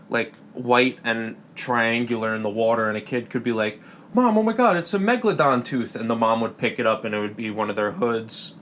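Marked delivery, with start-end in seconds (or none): 4.1-5.9 put-on child calling to his mom in excitement; excited kid. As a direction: gasp it out like a kid who found treasure. Kid finds something in water.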